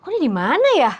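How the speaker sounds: high, startled question. Retold or imitated. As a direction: wake up confused and alarmed. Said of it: "dia kaget gitu". (kaget bingung, suara tinggi)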